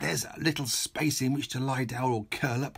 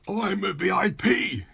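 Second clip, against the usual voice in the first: higher, louder indignant mayor; furious yell; 8 semitones higher, 4 dB louder than usual. Acted. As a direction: yell it at the top of his voice. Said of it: "he yelled at the top of his voice".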